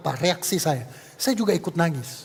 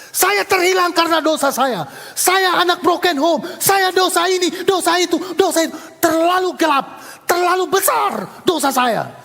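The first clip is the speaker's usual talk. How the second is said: raised, louder, slowed testimony; impassioned preaching; 8 semitones higher, 4 dB louder than usual. Acted. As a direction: preach your own brokenness with force. Preaching testimony at full force. (kesaksian berkhotbah dengan penuh semangat, suara tinggi dan keras)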